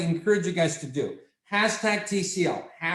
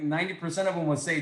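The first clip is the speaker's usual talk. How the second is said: usual speaking voice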